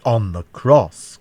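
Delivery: loud, slow outraged cry. A narrator's reading. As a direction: cry it out forcefully, stretching each word. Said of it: "cried I".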